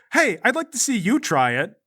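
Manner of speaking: high, defensive retort; defensive challenge. Acted. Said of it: "He goes".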